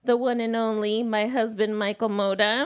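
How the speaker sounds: ordinary delivery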